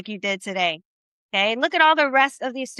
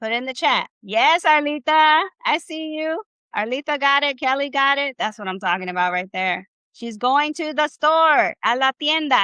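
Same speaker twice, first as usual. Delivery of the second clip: usual speaking voice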